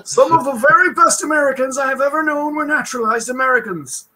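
put-on high, old-time speechmaker voice; Teddy Roosevelt impression. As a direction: boom it out like an old president at a podium. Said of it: "however you wanna do Theodore Roosevelt in this".

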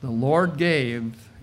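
ordinary talk

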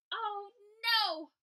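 high loud alarmed oh no; buzzing alarm. A narrator's reading. Bees alarmed at the news, high loud oh no. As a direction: gasp out in alarm. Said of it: "buzzed the bees".

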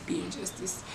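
whispered under the breath, slightly off-mic; whispered self-correction. Shown as a demonstration. Catching her own slip, she whispers it again. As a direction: murmur the flubbed phrase to yourself. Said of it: "What is wrong with me?".

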